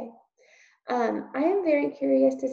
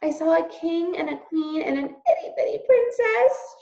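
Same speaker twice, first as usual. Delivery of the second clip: high loud shout with a cry in it; a little girl shouting, higher and louder, near tears; 9 semitones higher, 5 dB louder than usual. Acted. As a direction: shout it so Uncle hears. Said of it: "shouted Meg".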